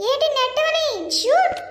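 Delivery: high, excited escape cry; urgent call to flee. Acted. Victim tells friend to run from biryani, urgent high call. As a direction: call out the getaway in a high comic yelp.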